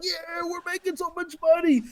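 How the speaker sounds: high-pitched mock cheer; mock celebration. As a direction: imitate a giddy crowd celebrating. Acts out company celebrating its success.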